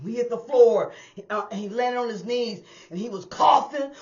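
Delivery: normal talking voice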